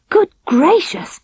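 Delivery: high, slow gasp of surprise; startled by the clock striking; startled exclamation. A narrator's reading. Princess hears the castle clock strike three, startled. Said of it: "exclaimed the ordinary princess".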